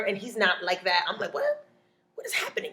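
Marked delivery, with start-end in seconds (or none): 1.3-2.7 high, breathless disbelief; shocked. Retold or imitated. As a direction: gasp it out in shock. Shocked by the window smacking.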